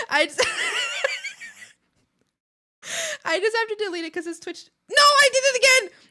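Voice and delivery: high, loud, laughing exasperation; squealing with laughter at the blunder; laughing disbelief. Real, not acted. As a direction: laugh out loud at your own mistake. Keeps deleting wrong message.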